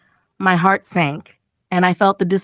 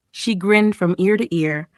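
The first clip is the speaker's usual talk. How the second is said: usual speaking voice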